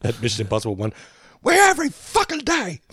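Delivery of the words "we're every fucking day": high, strained mock rant; imitation of a star losing it, pitched way up; imitated Cruise rant. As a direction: act out an on-set outburst. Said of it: "his rants on set and stuff".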